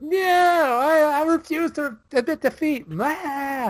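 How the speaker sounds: very high, whining mock tantrum; hammed-up babyish protest, ending in a wail; baby tantrum villain. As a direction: wail like a bad guy who can't lose. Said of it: "baby throwing a tantrum bad guy".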